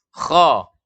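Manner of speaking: KHO heavy with a throat scrape; thick kho. Shown as a demonstration. Teacher sums up the letter, thick kho. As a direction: say "kho" as if clearing phlegm. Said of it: "Itu cara membaca huruf".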